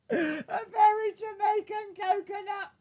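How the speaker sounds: very high squeaky squeal; helpless amusement. Real, not acted. Streamer cracking up at a viewer's name, high squeal. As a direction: squeal it through real laughter.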